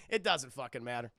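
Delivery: ordinary talk, nothing marked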